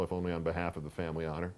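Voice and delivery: usual speaking voice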